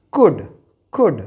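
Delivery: could said as cud, no l; silent l demo. Shown as a demonstration. Teacher showing students the silent l in could.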